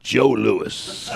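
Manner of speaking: put-on punchline in an elderly voice; old man. Old man naming the wrong champ, confident aged voice. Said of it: "And the old man".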